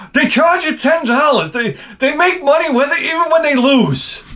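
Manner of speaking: high mocking imitation of his wife. Wife explaining the game's pricing, in a high mocking voice. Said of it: "My wife".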